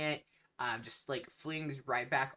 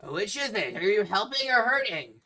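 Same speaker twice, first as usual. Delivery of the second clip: loud, high-pitched captain calling out; captain's yell; 7 semitones higher, 10 dB louder than usual. Acted. Captain demanding an answer from the crew, yelled. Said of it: "kind of yell to you".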